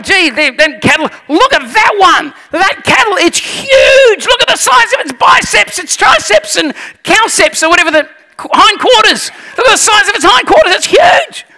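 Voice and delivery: very high, hammed-up astonishment; gawping farm visitor, squealing in wonder; awed amazement. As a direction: marvel at a giant prize animal. Awed visitor admiring Rob's cattle.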